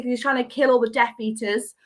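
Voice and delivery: ordinary talk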